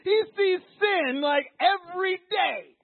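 high, loud, building to a cry; fervent preaching. Real, not acted. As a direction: pour passion into it.

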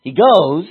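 emphatic "goes"; stress on goes. Shown as a demonstration. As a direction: hit "goes" hard. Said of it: "I emphasize goes".

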